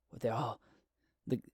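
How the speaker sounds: ordinary delivery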